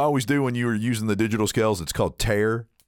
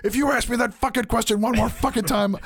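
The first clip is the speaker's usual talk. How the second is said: much higher, rising irritation; exasperated threat; 10 semitones higher than usual. Retold or imitated. Person fed up with being asked if they're okay, exasperated. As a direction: warn them you're about to snap. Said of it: "been like".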